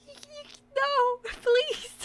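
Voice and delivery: high, whimpering mock plea; whiny pleading. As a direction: beg in a tiny whine. Pleading about old age.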